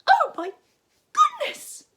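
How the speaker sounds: very high, loud gasping shriek; startled Gran gasping. A narrator's reading. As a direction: gasp it out in shock. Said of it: "gasped Gran".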